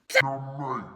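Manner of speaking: loud, low mock yell; shocked yell. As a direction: bark it out in shock. Catching dog peeing.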